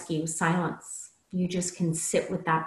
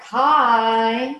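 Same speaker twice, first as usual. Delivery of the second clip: called from far across the room, higher and louder; distant greeting; 5 semitones higher, 8 dB louder than usual. Shown as a demonstration. Coach showing a distant, disengaged speaker, far-off hello. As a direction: sound like you're way over there. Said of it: "talking to you from way over here".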